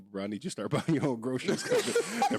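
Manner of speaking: amused plea to the roommate, laughing through it; laughing while retelling. Retold or imitated. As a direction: tell roommate to buy own groceries, laughing. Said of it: "I'm like".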